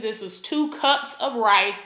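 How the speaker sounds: normal talking voice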